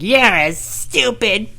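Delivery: high, very drawn-out taunt; slow, whiny high-pitched mock yell; kid yelling from afar. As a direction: stretch each insult like a bratty kid calling out. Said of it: "He's yelling me from the cul-de-sac".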